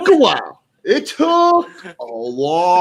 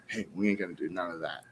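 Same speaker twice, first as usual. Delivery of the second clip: mock hushed aside, much lower and softer; low mumbled quote; 11 semitones lower, 16 dB quieter, faster than usual. Retelling Heath's whisper to Joe, low and hushed. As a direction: mutter it like a secret change of plan. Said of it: "decides to go up to Joe and say".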